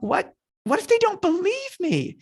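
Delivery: high, stammering worry; mock panic. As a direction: fret in a squeaky, hammed-up voice. Moses worrying to God.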